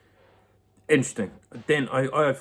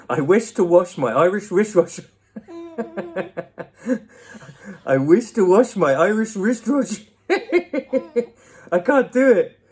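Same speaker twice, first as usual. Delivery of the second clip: higher and louder, cracking up mid twister; laughing; 9 semitones higher, 6 dB louder than usual. Real, not acted. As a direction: keep losing it to laughter. Tongue twister, can't stop laughing.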